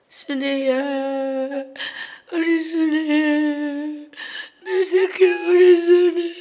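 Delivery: slow calling that builds to a loud tearful cry; mother crying out. Retold or imitated. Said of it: "하면서 엄마 자꾸 내 이름을 부른다". (점점 커지는 울음 섞인 부름)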